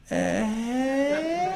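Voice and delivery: high drawn-out dazed "Eh?"; a lost, puzzled grunt. Retold or imitated. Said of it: "and he goes".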